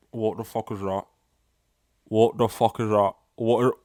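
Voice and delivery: lightly acted mouth-full disgust; half-garble it, as if round a lump; mock disgusted drinker. Drinker finding a lump from the can, mock disgust. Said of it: "a lump, like, leaves the can into your mouth".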